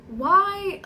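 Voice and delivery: high, loud exasperated why. Retold or imitated. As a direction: throw the question out in disbelief. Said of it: "And I'm just like".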